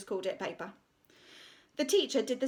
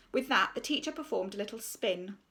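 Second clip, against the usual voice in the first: plain reading voice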